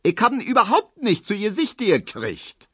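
very high, indignant protest; high-pitched clamouring granny; scolding old woman. A narrator's reading. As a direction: nag in a screechy high voice. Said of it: "zetert Frau Neuendorf".

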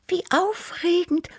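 very high, whispery purring cat; Tibi. A narrator's reading. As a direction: purr it in an excited high whisper. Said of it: "schnurrte Tibi". (hoch und hauchig schnurrend)

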